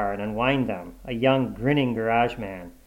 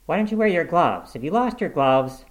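higher-pitched, pressing questions; nagging wife; 6 semitones higher, 5 dB louder than usual. A narrator's reading. Wife checking on Mitty's gloves, nagging. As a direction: scold him like a nagging wife.

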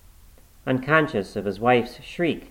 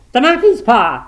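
high, loud frantic shout; frenzied old woman's cry; wild cry; 14 semitones higher, 10 dB louder than usual. A narrator's reading. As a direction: shout it wildly as the idea strikes. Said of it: "she cried wildly".

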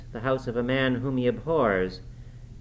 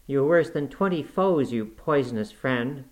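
ordinary delivery, nothing marked

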